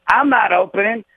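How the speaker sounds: high, indignant mock protest; puffed-up comic outrage, pitched high; wounded ego. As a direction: act out an offended headliner refusing the gig. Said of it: "right away, the ego kicks in".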